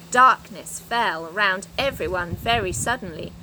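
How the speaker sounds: usual speaking voice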